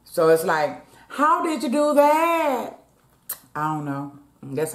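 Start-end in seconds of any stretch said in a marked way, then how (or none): 1.1-2.8 drawn-out high "how did you do that"; playful slow astonished question; mock disbelief. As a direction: act baffled at yourself, slow and high.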